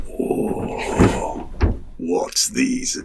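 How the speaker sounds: hushed, breathy imitation of amazed kids; drawn-out wonder, almost voiceless; awestruck kids. Kids seeing the motorbikes for the first time, hushed awe.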